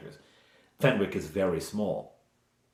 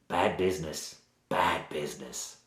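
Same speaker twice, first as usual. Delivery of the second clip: low, soft Cockney warning; bad Cockney accent; 5 semitones lower, 7 dB quieter than usual. Village woman warning strangers off that end of the village, in bad Cockney. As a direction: drop low and ominous in mock Cockney. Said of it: "she has a sort of bad Cockney accent".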